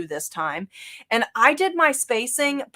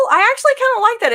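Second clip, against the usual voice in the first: excited; 14 semitones higher, 7 dB louder, much faster than usual. Real, not acted. Excited about the stitch look.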